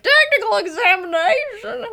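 high, loud wailing disbelief; mock panic. Forgers learn of the examination, high loud mock-panicked wail. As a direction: shriek it back in comic horror. Forgers' horrified echo of the question.

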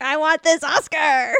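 hammed-up Oscar hunger; mildly strained, insistent imitation; mock demand. Actor baring all for an Oscar, mock demanding. Mocking actor hungry for an award.

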